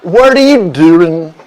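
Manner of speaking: hammed-up, drawn-out Californian accent; loud, slow, stretched California vowels; Saturday Night Live Californians. Answering host with the SNL skit, exaggerated Californian accent. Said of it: "It's like that Saturday Night Live skit".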